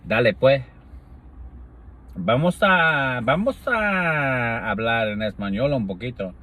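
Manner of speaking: lightly hammed-up Spanish. Switching to Spanish in a playful, lightly hammed-up voice. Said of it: "I do the accents".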